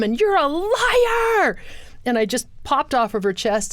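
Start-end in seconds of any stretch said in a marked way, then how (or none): 0.0-1.6 defiant outburst. Retold or imitated. Child denies news of the murder.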